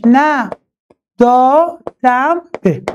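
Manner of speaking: very slow, each syllable of نه دادم به separated; slow metrical chant of نه دادم به; syllable-by-syllable scansion. Shown as a demonstration. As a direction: tap out نه دادم به for its meter. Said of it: "نگاه کنید".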